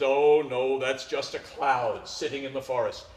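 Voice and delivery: a hint of a steady dad brushing off fears; soothing father.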